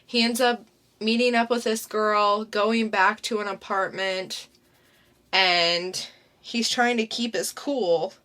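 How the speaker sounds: ordinary talk, nothing marked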